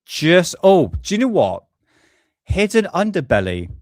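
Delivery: ordinary talk, nothing marked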